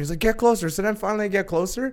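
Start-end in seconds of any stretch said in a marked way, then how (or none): 0.2-0.7 high-pitched insistent command; mock yell, raised pitch; yelled order. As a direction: imitate an instructor calling out sharply. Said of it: "The guy's yelling at me".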